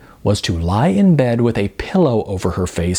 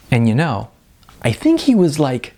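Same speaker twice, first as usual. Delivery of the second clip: higher, gushing, fast chatter; bubbly starlet; 5 semitones higher than usual. A narrator's reading. Model telling the class about her film, bubbly. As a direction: gush about your movie role breathlessly. Tracy's voice continues.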